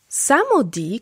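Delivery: ordinary talk, nothing marked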